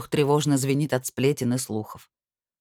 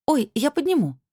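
startled eager offer; 11 semitones higher than usual. Acted. Quick offer to help.

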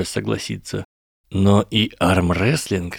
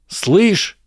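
high, sharp call; shout; 11 semitones higher than usual. A narrator's reading. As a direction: call out to get attention. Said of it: "крикнул Эрни". (резкий окрик)

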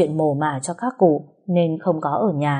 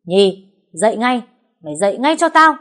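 loud, high-pitched angry command; raised angry voice, climbing higher; angry shouted order; 5 semitones higher, 5 dB louder than usual. A narrator's reading. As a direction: rouse someone with a furious order. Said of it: "quát lớn".

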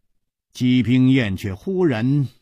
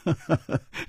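轻轻一声笑，带点得意; 含笑轻哼一声; 微微一笑; 8 semitones higher than usual. Acted. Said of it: "他微微一笑". (楚留香得意地轻笑)

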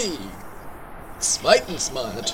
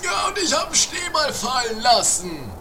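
triumphant boast; 8 semitones higher than usual. Pretend. Triumphant boast in pretend play.

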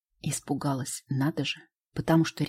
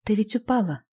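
trembling voice; 6 semitones higher, 3 dB louder than usual. Acted. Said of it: "Голос Гали дрожит".

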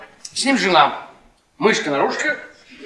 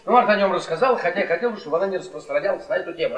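usual speaking voice

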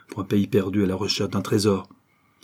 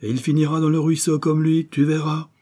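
scornful husband; 8 semitones higher, 4 dB louder than usual. A narrator's reading. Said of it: "poursuivit monsieur Higginbotham".

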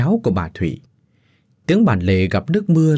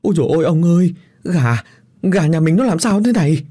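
higher, louder frightened cry; terrified wail; 7 semitones higher, 3 dB louder than usual. A narrator's reading. Wife pointing at the dead chickens, frightened wail. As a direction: cry out in panic to the husband. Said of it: "run rẩy lắp bắp".

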